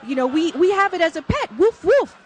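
high, loud mock Italian accent with a laugh and woof barks; Italian man explaining, with barks. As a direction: play the Italian guest barking woof to mean pet. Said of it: "They said".

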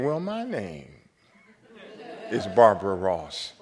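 lightly voiced elderly lady; a hint of a frail old woman. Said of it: "And the voice said".

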